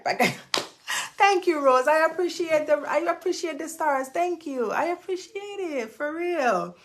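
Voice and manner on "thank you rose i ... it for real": high, overwhelmed and teary; thanks squeezed out near tears; emotional thanks. Real, not acted. Host thanking Rose for stars, high and teary. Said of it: "I feel like crying".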